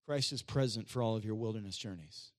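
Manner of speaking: low, soft statement to the church; low-pitched quiet reassurance; hushed key line. Real, not acted. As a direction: say it low and softly, letting the end fall.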